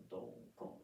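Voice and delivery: "don, kon" breathed out soft and unvoiced like a light tap; whispered don kon. Shown as a demonstration. Teacher whispering "don, kon" as the soft take. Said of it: "the count is gonna be".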